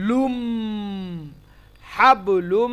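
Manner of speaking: "হাবলুম" with a long humming nasal m; ghunna. Shown as a demonstration. Teacher demonstrating ghunna for students, nasal held m. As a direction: hold the final m of "লুম" through the nose. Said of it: "গুণনা হবে এবার".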